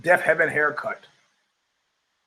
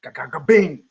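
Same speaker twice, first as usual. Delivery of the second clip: punch sound effects. Retold or imitated.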